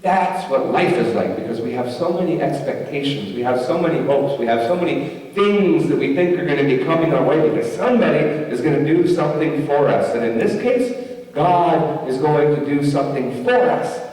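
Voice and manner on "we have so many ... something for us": usual speaking voice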